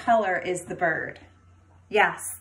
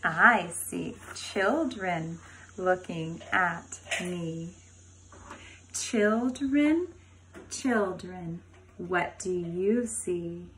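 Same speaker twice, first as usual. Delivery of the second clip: put-on slow, sing-song chant for little kids; reading to a toddler; much slower than usual. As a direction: chant the rhyme to a class of five-year-olds. Reads book to kindergarten.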